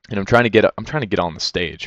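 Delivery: ordinary talk, nothing marked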